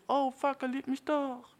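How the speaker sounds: high-pitched mock girlfriend realisation; Freundin begreift es. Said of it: "genau dann wusste sie". (hoch nachgeäffte, überraschte Freundin)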